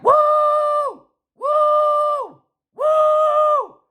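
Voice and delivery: very high, loud wrestler's woo; Ric Flair woo. Retold or imitated. Roaring engine imitated as a wild wrestler woo. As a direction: scream it like a wrestling legend. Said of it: "it sounded like Ric Flair".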